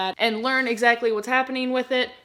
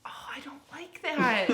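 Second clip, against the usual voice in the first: whispering; 4 semitones higher, 5 dB quieter than usual. Real, not acted. Touching something unpleasant.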